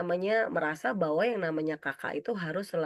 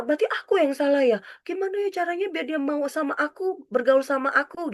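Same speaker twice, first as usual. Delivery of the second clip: higher-pitched, acted self-doubting worry; fretful, raised pitch; anxious child; 8 semitones higher than usual. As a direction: voice a child fretting it is their fault. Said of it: "dia tuh cemas banget".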